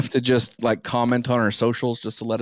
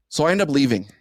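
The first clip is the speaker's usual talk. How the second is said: ordinary delivery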